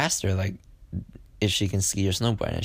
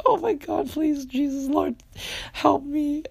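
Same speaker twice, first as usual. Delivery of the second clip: squeaky falsetto plea, much higher, hammed-up; mock panicked prayer; slower than usual. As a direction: beg the Lord in a comic high squeal. Panicked prayer after hitting send.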